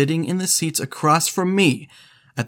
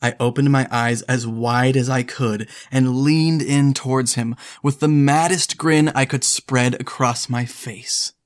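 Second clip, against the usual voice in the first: ordinary delivery, nothing marked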